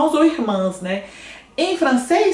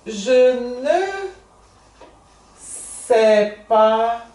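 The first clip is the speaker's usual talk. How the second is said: slow model phrase; much slower than usual. Shown as a demonstration. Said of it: "Então".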